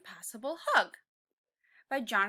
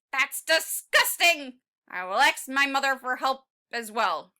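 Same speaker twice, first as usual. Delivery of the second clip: disgusted exclaim; 9 dB louder than usual. Acted. Tiny T-Rex rejecting cucumber juice loudly. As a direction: spit out the disgust.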